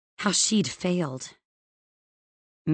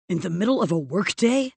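mildly angry, indignant question; a touch of fury, voice slightly raised; furious question; 3 dB louder than usual. A narrator's reading. Boss following Evie close behind, mildly furious question. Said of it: "he asked furiously".

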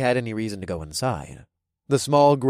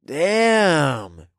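impressed bro; 3 semitones higher, 3 dB louder than usual. A narrator's reading. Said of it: "Toby said with a whistle".

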